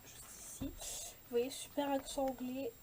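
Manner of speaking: ordinary delivery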